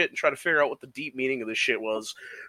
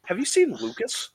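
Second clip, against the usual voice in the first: mock high tourist voice; 8 semitones higher than usual. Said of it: "And they're like".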